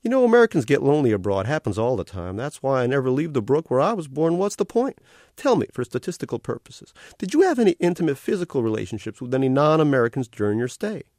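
cartoon otter greeting a new arrival, all sugary cheer; carnival otter voice. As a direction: gush like a cute carnival mascot.

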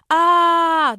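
loud high panicked scream "Ah!". Acted. Front passenger screaming as tampon slides down shirt, high loud shriek. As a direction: shriek like you're on fire. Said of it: "the dude screamed".

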